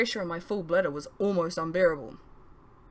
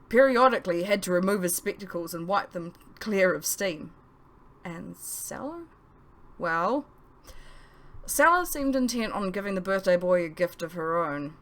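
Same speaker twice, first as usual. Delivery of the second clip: usual speaking voice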